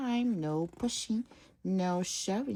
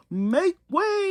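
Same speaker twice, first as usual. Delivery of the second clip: loud high yell; yelling limo; 12 semitones higher, 7 dB louder than usual. Acted. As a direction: clear the road with it. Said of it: "yelled the limousine".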